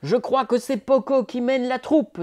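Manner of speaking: a hint of father's pride. A narrator's reading. Father telling mother that Poco leads. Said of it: "dit son père".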